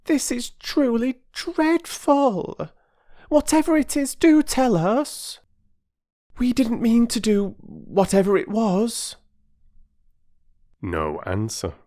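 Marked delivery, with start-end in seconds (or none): 0.1-9.2 put-on very high, very slow pleading girl's voice; a desperate child begging; pleading little girl. Phyllis begging Perks to explain, pleading.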